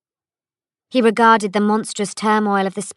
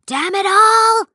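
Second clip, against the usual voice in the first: much higher, much louder, slow roar; furious cry; 13 semitones higher, 9 dB louder, slower than usual. A narrator's reading. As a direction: bellow it as blades come at him. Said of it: "cried the man called Nale".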